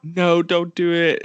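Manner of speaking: high, drawn-out plea; retold plea of an upset kid, slow and high; upset pleading. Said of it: "I was really upset".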